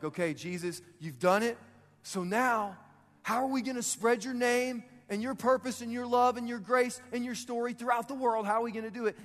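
thrilled angel pressing for the plan; excited Gabriel. Acted. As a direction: pile up the list with mounting excitement. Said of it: "but he's so excited".

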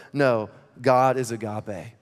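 normal talking voice

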